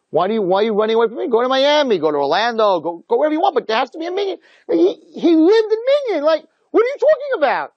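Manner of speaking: high-pitched, escalating exasperation; exasperated rant. Acted. Acting out exasperated rant about father.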